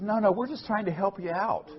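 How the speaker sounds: high, mock soothing insider; mock reassuring. The insider reassuring the speaker, high and mock soothing. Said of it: "He says".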